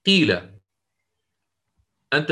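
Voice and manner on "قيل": قيل with a brief u-colour before the i; ishmam demo. Shown as a demonstration. Teacher reciting قيل for students, u gliding into i. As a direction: say قيل starting from a short damma and gliding into kasra. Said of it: "مثلًا نطبق، يلا".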